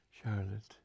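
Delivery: usual speaking voice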